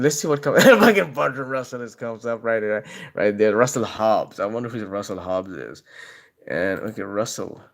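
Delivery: ordinary talk, nothing marked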